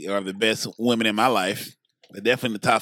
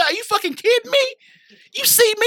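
high, indignant disbelief; mock outrage, voice climbing high; incredulous outburst; 4 dB louder than usual. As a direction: retell your shock, pitched way up. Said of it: "I said".

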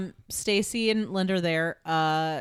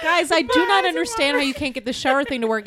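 loud, higher, laughing exasperation; animated disbelief; 7 semitones higher, 4 dB louder than usual. Real, not acted. Host baffled that friends fail with it, laughing disbelief. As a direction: protest to the group, pitched up and loud.

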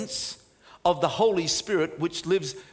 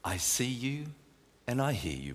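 soft, lower, fading into a whisper; calming hushed voice; 6 semitones lower, 8 dB quieter than usual. Acted. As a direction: breathe it close as comfort. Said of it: "he has such a calming voice".

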